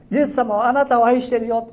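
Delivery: high, loud proclaiming shout; loud call. Retold or imitated. The Spirit's command to shout at the mailbox, loud and high. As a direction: shout the blessing out to the house. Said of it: "大きな声で言いなさい".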